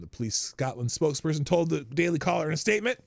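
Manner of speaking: ordinary talk, nothing marked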